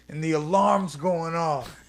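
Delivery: ordinary talk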